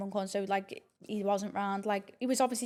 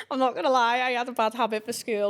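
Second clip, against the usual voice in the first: amused, words breaking out of a laugh; laughing confession; 2 semitones higher, 6 dB louder than usual. Real, not acted. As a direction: giggle while owning up. Laughs while confessing bad habit.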